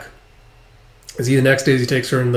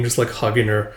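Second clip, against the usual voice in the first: normal talking voice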